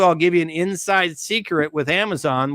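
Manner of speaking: normal talking voice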